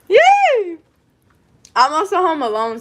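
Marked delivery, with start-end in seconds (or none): 0.1-0.8 very high, loud cheer; gleeful scream. Real, not acted. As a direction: let out a piercing cheer of delight. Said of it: "so I just like to scream".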